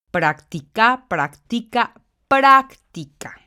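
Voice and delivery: teacher demo: práctica, growing stress on PRÁC; stressing the tonic syllable. Shown as a demonstration. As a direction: build up to a loud slow práctica, PRÁC stressed. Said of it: "la sílaba tónica igualmente está en la antepenúltima".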